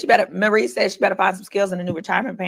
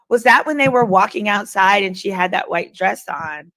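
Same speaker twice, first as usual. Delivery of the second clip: usual speaking voice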